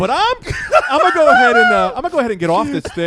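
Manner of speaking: high, hammed-up timid little kid; mimicking his younger self asking to get off the ride; mocking own timid voice. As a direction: play your scared younger self, squeaky and polite. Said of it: "continuing quote".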